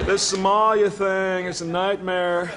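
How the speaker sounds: high, drawn-out plaintive voice; plaintive president. Retold or imitated. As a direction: imitate a president moaning late at night. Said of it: "I hear this plaintive voice".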